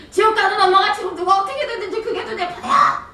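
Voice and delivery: high, frustrated yelling at mother; angry outburst. Retold or imitated. Daughter snapping at her mother in the car, angry outburst. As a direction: let the anger burst out at her. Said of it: "엄마한테 제가 막 화를 냈어요".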